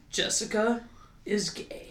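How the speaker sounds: soft, grave reveal; solemn quiet announcement. Retold or imitated. Dad telling mom the news, quiet solemn reveal. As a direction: drop it quietly like big news. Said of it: "And he was like".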